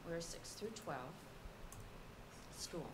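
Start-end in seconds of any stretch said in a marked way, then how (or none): none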